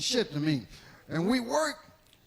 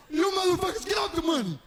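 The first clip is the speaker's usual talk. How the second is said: shouted stickup, higher and louder; mock tough stickup; 9 semitones higher, 5 dB louder, faster than usual. Young comic robs mafia office with loud hammed-up threat. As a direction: bust in barking like a tough robber.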